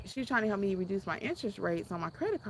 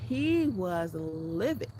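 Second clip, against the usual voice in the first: ordinary delivery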